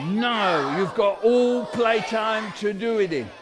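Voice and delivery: loud, slow, stern refusal; stern schoolteacher voice; strict teacher. Acted. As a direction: deny it firmly, stretching the words. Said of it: "And she'd say".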